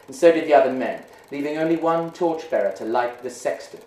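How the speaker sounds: plain reading voice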